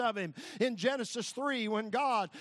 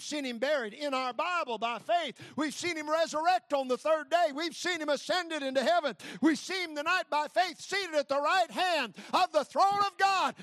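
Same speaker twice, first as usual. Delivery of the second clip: preaching; 6 semitones higher, 3 dB louder than usual. Acted. Rising sermon climax.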